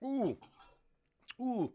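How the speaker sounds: wincing at strong coffee; reacting to strong coffee. Real, not acted. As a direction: gasp after bitter coffee. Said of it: "that's strong coffee".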